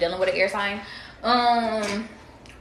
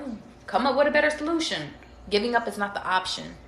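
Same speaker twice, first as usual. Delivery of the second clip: ordinary talk, nothing marked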